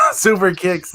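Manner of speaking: laughing through the words; can't stop laughing. Real, not acted.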